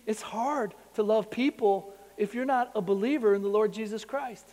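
normal talking voice

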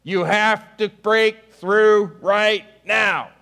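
slow, high shouted demand; pleading shout. Acted. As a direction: shout it up to heaven, slow and urgent.

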